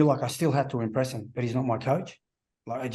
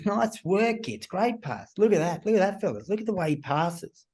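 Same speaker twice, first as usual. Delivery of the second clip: higher, hammed-up coach cheering the lads on; mock coach praise; 6 semitones higher than usual. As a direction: imitate the coach gushing over a teammate's passing. Coach praising teammates at training.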